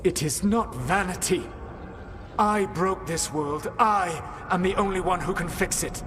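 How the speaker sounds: high, urgent protest; stung defiance. Acted. Accused mage insists only he can fix the world. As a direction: defend yourself hotly. Heated argument with Rook.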